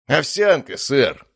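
ceremonious butler-style announcement; solemn announcement. Acted. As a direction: announce the dish with mock grandeur. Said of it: "торжественно объявил Матвей".

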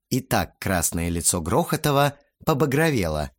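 ordinary delivery

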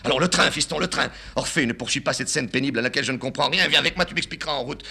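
put-on high, insistent old man; father urging his son onto the train, high; agitated father. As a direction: press the son to come.

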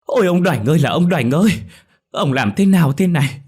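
loud, high wailing lament; bursting into wails. A narrator's reading. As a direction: wail for the lost husband. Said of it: "khóc òa lên".

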